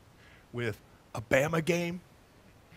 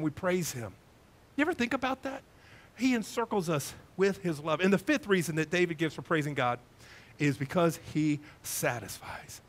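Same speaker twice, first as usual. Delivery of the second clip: normal talking voice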